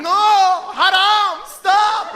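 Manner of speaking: high, wailing shouts of protest; hammed-up pleading dad; mock begging. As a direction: beg loudly, as if crying. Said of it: "He's begging".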